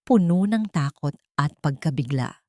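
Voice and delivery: ordinary delivery, nothing marked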